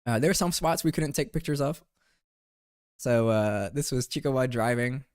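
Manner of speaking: ordinary talk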